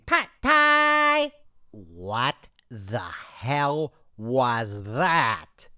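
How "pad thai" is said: involuntary shout of Pad Thai; sudden outburst. Acted. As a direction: blurt Pad Thai much higher and louder. Blurts dish name in outburst.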